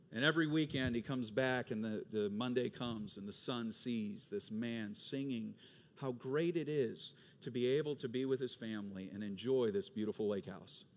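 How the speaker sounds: ordinary talk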